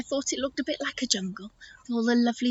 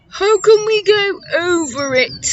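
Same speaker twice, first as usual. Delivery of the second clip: higher, loud elephant question; Eddie the elephant; 8 semitones higher, 6 dB louder, slower than usual. Acted. Elephant asking about the slimy swamp, loud and higher. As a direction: boom it out higher as an anxious elephant.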